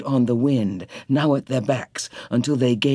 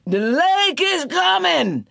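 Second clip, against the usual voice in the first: crying out. A narrator's reading. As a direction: call out the warning to the shanties. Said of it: "They cried out as best they could".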